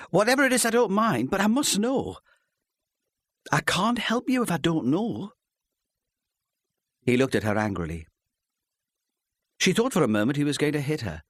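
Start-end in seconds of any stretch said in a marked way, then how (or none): 0.1-5.3 pleading. A narrator's reading. Wife pleading with husband.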